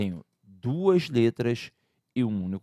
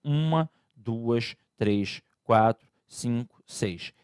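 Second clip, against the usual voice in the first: counting letters one by one, slow; slower than usual. Shown as a demonstration. Teacher counts the letters slowly for students. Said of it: "é só contar, ó".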